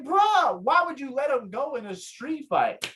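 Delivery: high, disbelieving mock protest; incredulous. Retelling friends' shock at the release, incredulous. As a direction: voice the whole crew's exasperated disbelief.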